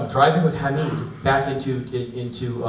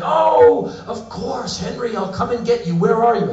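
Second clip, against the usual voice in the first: much higher, eager woman's phone voice; 10 semitones higher than usual. Retold or imitated. Actress answering Henry's call, much higher eager woman. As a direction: sound delighted to hear from him.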